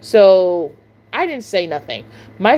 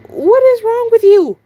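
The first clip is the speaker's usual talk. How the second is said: much higher and louder, indignant; incredulous outrage; 11 semitones higher, 5 dB louder than usual. Retold or imitated. Cashier confronting a staring customer in thought, loud incredulous outrage. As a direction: demand an answer in disbelief.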